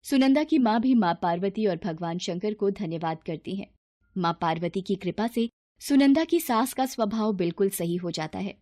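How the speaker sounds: usual speaking voice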